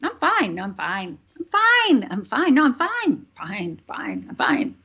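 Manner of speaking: overdone mock reassurance; insisting she's fine. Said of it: "I walked around saying".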